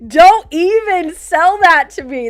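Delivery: loud, drawn-out mock accusation; hammed-up, slow and loud scolding; mock outrage. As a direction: stretch every word out in loud, laughing disbelief. Mock-outraged rebuttal, laughing.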